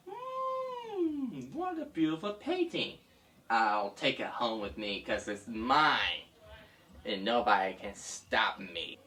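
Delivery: greedy villain purring over a prize; a touch of sly possessive villain; lightly voiced smug thief. Villain admires stolen painting.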